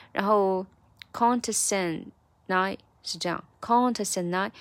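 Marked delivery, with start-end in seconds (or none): 3.6-4.5 "contest and I" linked, first syllable stretched; stress on CON. Shown as a demonstration. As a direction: lean long on the "con" of "contest and I". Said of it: "这个con要重读出来".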